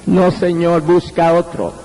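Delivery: low, understated plea; tearful refusal. Retold or imitated. Preacher retells repeating his refusal to God, low and tearful. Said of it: "le repetí la misma negativa".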